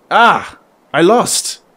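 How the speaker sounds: startled, then dismayed at losing; surprised cry. Real, not acted. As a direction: blurt it out, caught off guard. Losing the game.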